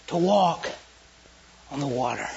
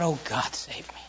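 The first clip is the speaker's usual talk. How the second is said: hushed cry; 6 semitones lower, 12 dB quieter than usual. Acted. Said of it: "he cried out".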